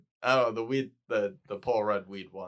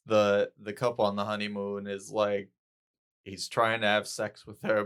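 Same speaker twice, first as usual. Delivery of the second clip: usual speaking voice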